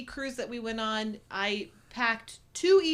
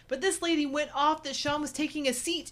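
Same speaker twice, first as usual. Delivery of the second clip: ordinary talk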